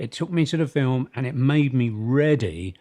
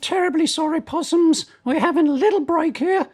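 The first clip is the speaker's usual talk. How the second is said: very high falsetto Australian grande dame; Dame Edna; 4 dB louder than usual. Acted. Host apologises to audience for a break, terrible Dame Edna impersonation. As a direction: put on a high, theatrical housewife-superstar voice. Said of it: "I'm doing a terrible, uh, Barry Humphries impersonation".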